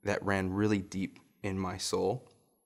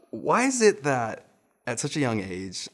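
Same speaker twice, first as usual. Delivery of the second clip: ordinary talk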